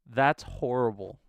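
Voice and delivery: loud, slow, disgusted verdict; exasperated groan. Real, not acted. Gamer reacting to his poor typing score, loud and disgusted. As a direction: drag it out in real disgust.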